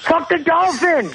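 high hammed-up yelling at a whale; high mock rant. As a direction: squeal it out, mock furious.

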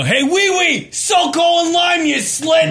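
high yelled impression of the guy; mock guy voice. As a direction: shout it up high like the dude. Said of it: "I'm just waiting for fucking Joe to go".

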